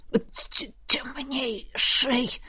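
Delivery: soft, stammering, breathy whimper; terrified bleat. A narrator's reading. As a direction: stutter it out in sheer fright. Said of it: "проблеял позеленевший принц".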